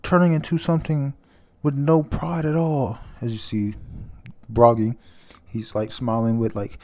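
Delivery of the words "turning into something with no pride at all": high, pleading yell; anguished raised voice; screaming. Retold or imitated. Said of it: "As he screams".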